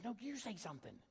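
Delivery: soft, secretive insistence; mouthed retort. Retold or imitated. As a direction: push it back in a stage whisper. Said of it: "she mouths".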